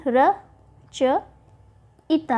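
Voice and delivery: र च इता as slow spaced syllables. Shown as a demonstration. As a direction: split into slow separate syllables. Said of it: "रचयिता होता है".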